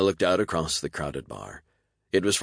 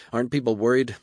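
arguing; 7 semitones higher, 5 dB louder, faster than usual. A narrator's reading. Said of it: "Hannah argued".

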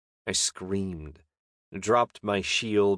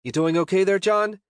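high, louder call rising to the name; 11 semitones higher, 5 dB louder than usual. A narrator's reading. Chessa calls to her struggling friend, high and loud. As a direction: call across the room to check on him. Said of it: "Chessa called".

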